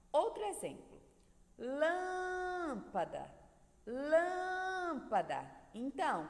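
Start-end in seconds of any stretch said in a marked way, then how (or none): 1.6-5.5 Lâmpada with a very long, high LÂM; LÂÂÂM-pada, stretched then falling; stress on LÂM. Shown as a demonstration. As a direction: call out lâmpada, holding the first syllable. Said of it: "lã é a sílaba mais forte".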